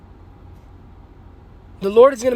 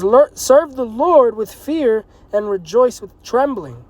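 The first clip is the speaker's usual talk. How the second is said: slow, raised preacher's reading; slow scripture reading; 3 semitones higher, 4 dB louder, slower than usual. Acted. Preacher reading the command to serve, slow and raised. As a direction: stretch the verse out.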